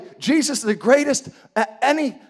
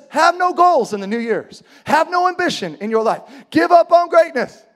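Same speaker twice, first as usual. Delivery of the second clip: raised, forceful proclamation building to a shout; loud preacher's list, climbing higher; mock commands; 7 semitones higher, 6 dB louder than usual. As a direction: hammer out each false commandment. More commands Jesus never gave.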